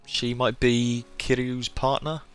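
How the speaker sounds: ordinary delivery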